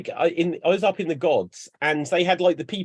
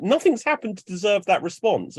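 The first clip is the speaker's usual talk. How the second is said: usual speaking voice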